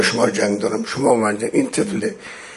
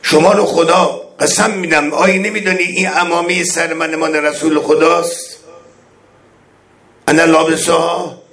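higher, louder pleading call to the army; imploring imam; 7 semitones higher, 3 dB louder than usual. Acted. Imam calls out to the enemy army, higher and louder beseeching. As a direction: beseech them by God to recognize you. Voices Imam's oath-pleas.

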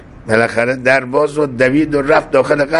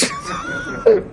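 high, soft wheezy laugh; amused laugh; 5 dB quieter than usual. Real, not acted. Storyteller tickled by the wife request, amused laugh. Chuckles at his own tale.

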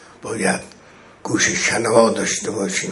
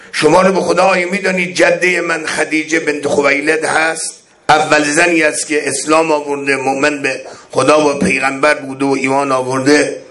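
higher, louder pleading call to the army; imploring imam; 5 semitones higher, 3 dB louder than usual. Acted. Imam calls out to the enemy army, higher and louder beseeching. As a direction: beseech them by God to recognize you. Voices Imam's oath-pleas.

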